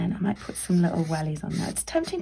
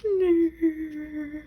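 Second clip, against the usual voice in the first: mock sad snowman; 9 semitones higher than usual. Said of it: "If my snowman was really sad".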